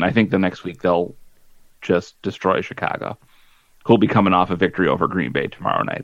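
ordinary talk

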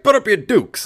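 very high, loud, hammed-up thirties pugilist challenge; old-time boxer voice. Heckler challenging the comedians, hammed-up old-time fighter voice. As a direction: crow it like a cartoon prizefighter. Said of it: "like a thirties style pugilist".

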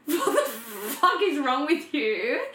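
slow, incredulous question through laughter; disbelieving sister asks what's wrong with her, laughing; laughing disbelief. Retold or imitated. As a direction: laugh at how absurd it is.

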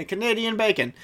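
ordinary talk, nothing marked

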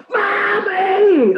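high, loud mock scream of Mommy; imitated scared scream. Daughter screams for mom over a spider, frightened. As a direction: shriek for mum in fright. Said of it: "she was like".

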